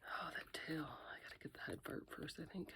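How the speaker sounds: whispering. Real, not acted. Careful demolding, whispers.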